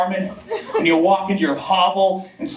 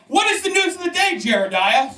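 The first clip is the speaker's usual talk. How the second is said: mock olde townsfolk; 9 semitones higher than usual.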